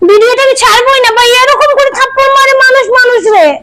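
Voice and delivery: wailing complaint. Real, not acted. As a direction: wail that he hit you. Wailing about the slap.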